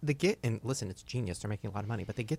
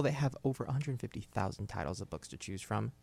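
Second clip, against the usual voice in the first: ordinary talk, nothing marked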